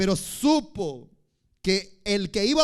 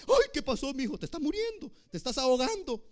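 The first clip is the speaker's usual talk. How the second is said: a light, higher mock-worried parent; fussing parent; 7 semitones higher than usual. Parent fussing over a crying toddler, lightly mock-alarmed. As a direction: fret over the child in a gently imitating voice.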